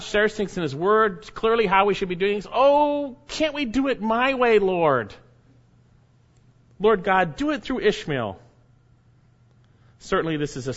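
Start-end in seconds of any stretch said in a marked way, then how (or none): 2.5-8.4 high, louder pleading with the Lord; mock-whiny plea to the Lord; whiny pleading prayer. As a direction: beg God to do it your way. Preacher voicing believer pleading with God.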